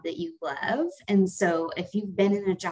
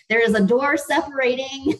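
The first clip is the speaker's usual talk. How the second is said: laughing through the words, higher and louder; breaking up laughing mid-sentence; 8 semitones higher, 8 dB louder than usual. Real, not acted. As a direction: giggle while getting it out.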